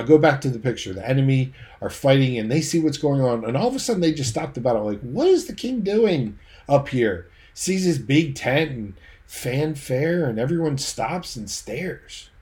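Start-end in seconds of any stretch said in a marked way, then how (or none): 5.0-7.3 high, drawn-out incredulous question; baffled enemies. Acted. Enemy soldiers halt the battle to gape, incredulous. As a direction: act out astonishment, stretching the words out.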